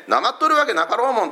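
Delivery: indignant Hakata dialect. Retold or imitated. A boy teased by church friends answers in Hakata dialect. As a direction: fire back fast in broad Hakata speech, denying any accent. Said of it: "お前なまってるな".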